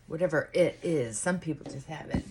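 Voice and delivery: ordinary talk, nothing marked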